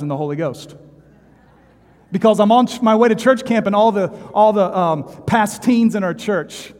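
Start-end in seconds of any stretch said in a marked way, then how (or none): 2.1-6.7 loud, high preaching burst; full-force preacher shout. Real, not acted. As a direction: belt it out from the pulpit.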